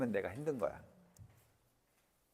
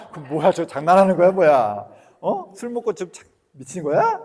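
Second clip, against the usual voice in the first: loud, high, laughing teasing; laughing disbelief; 7 semitones higher, 14 dB louder than usual. Retold or imitated. Son teasing his drunk father, loud and laughing. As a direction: laugh and tease him in disbelief. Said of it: "이렇게".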